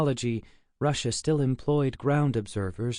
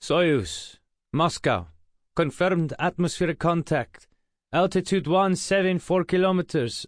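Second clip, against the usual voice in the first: Russian mission controller; 6 semitones higher, 3 dB louder than usual. A narrator's reading. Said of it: "a Russian voice replied".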